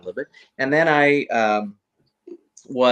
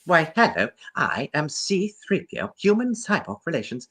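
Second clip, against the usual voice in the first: high, prim robot butler voice lifted well above his own; an eager first audition as the golden droid; tentative C-3PO impression for its own actor; 8 semitones higher than usual. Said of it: "I made a attempt".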